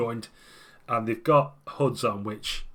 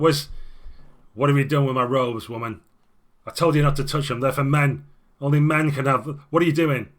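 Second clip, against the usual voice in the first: louder, raised angry demand; angry shout; 3 semitones higher, 6 dB louder than usual. Acted. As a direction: storm in demanding his robes. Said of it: "saying, like".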